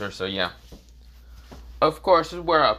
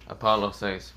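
ordinary delivery, nothing marked

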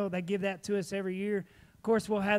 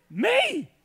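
high, loud surprised "Me?"; squeaky eager surprise, pitched way up; 13 semitones higher, 7 dB louder than usual. Retold or imitated. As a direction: jump at the offer in disbelief. Eager reply to tire offer.

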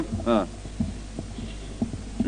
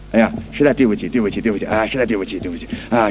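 apologetic polite driver; 10 semitones lower, faster than usual. Retold or imitated. A cyclist apologizing humbly to the man he hit, lightly voiced. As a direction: play the sorry cyclist begging pardon.

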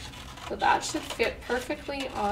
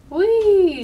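high, loud sledding cry of joy; gleeful whee; 10 semitones higher, 14 dB louder than usual. Acted. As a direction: squeal it out like flying downhill. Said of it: "sang Pam".

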